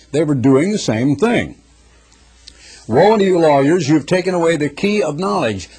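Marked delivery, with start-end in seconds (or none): 2.9-5.7 raised, louder scripture preaching. Acted. Preacher proclaiming woes to the lawyers. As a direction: declaim the woes with force.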